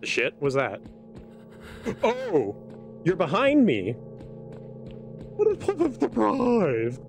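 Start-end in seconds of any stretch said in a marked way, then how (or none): 2.0-3.9 scared horror game streamer. Real, not acted. Monster appears behind. 3.9-7.0 slow, high, drawn-out sarcasm; sarcastic. Real, not acted. Sarcastic after the scare.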